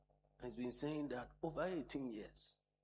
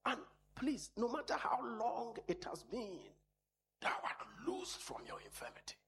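much higher, soaring declaration; preaching at full force; 12 semitones higher than usual. Real, not acted. Preacher declaring freedom over the congregation, soaring. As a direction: lift your voice and pronounce release.

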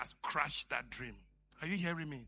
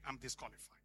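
1 defeated murmur; 7 semitones lower, 12 dB quieter, faster than usual. Acted. Gideon's defeated voice.

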